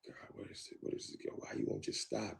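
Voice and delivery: whispered, exasperated plea. Retold or imitated. Begging his girlfriend in a frustrated whisper.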